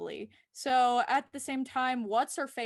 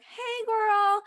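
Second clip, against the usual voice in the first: much higher, louder, slow bubbly gossip; mock chirpy Kara; 6 semitones higher, 6 dB louder, slower than usual. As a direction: deliver bad news like breezy girl talk. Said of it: "Kara is like".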